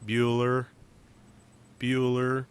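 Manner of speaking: drawn-out monotone roll call; boring guy. Acted. Host answering "the boring guy, right?", boring monotone impression. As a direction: drone it on one held note, with a long gap between. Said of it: "He's the boring guy, right?".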